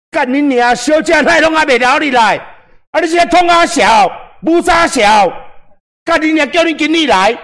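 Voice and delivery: high, loud furious shouting; enraged patron shouting at a hostess; angry customer yelling. Shown as a demonstration. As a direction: yell at the hostess, demand the manager. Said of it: "类似这样子哦".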